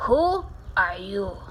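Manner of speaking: slow, drawn-out challenge; Don Diego. Acted. Gang leader challenging a stranger, slow growl. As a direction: question the stranger slowly and weightily. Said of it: "growled Don Diego".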